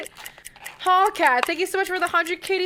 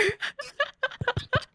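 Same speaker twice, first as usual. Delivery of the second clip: tearful laugh; 8 semitones higher, 6 dB quieter than usual. Real, not acted. Overwhelmed by gifts.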